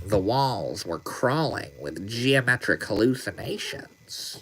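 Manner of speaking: soft, slow, dreamy psychedelic lecturer; Terrence McKenna voice. Pretend. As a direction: hush it like a spellbound storyteller of visions. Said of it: "Was it my Terrence McKenna voice?".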